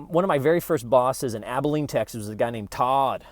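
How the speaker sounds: normal talking voice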